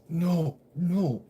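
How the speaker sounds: retold panic as the charges hit; panicked denial. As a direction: refuse to believe it. Panicking in court.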